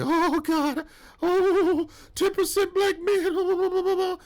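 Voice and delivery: shrill high mock alarm, drawn out slowly; mock panic. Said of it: "they were like".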